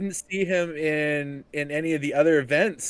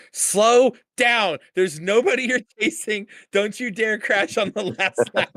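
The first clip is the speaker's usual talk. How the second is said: high, raised mock yell; retold yelling; 7 semitones higher, 3 dB louder than usual. Host retells urging the driver on the last lap, mock yelling. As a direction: re-enact yelling a warning at the screen. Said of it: "I was yelling at you like".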